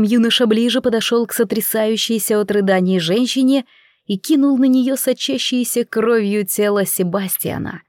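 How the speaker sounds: usual speaking voice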